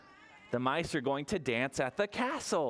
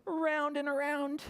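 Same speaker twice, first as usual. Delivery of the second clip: much higher lilting around and around; sing-song high; 10 semitones higher than usual. Shown as a demonstration. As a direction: twirl it with your voice. Sing-song repeat for twirling.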